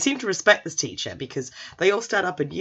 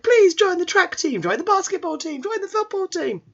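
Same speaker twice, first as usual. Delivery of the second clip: very high, insistent pleading; hammed-up squeaky plea; eager begging; 4 dB louder than usual. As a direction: beg like a desperate fan club. Delinquents beg him to join.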